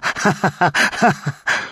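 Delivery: high, strange mocking laugh; mad laugh. A narrator's reading. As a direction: laugh in his face. Madwoman laughs at him.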